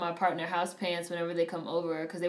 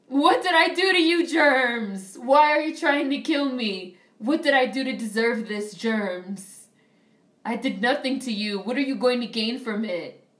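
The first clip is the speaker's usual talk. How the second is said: mock outrage; 4 semitones higher, 6 dB louder than usual. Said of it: "I was sitting in the movie theater like".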